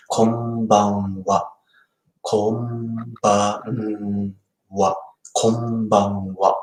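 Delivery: konbanwa said slowly, syllable by syllable; slow teaching demo. Shown as a demonstration. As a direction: spell out "konbanwa" slowly for the learner. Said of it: "teaching konbanwa slowly".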